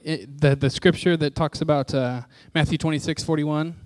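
ordinary delivery

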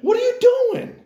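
very high, loud mock shout; exasperated yell. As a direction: yell at your crazy friend. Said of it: "I'm like".

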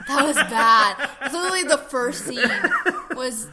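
laughing. Real, not acted. Laughing recalling Carrie shower scene.

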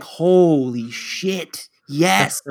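drawn-out, stunned agreement; hammed-up relief at being agreed with; emphatic realization. Husband answering wife's doubt about Brian, emphatic realization. Acted reaction to wife's question.

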